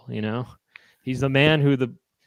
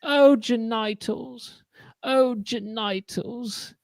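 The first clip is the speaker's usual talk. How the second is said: high, very slow dame voice; high society dame; 9 semitones higher, much slower than usual. Pretend. Dame addressing the uncle, high and very slow. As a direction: draw it out like a grand lady. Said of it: "She goes".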